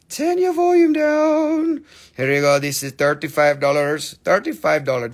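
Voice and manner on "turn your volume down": slow, much higher and louder shout; mock shout. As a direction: bellow it out with a grin. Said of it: "non-yelling".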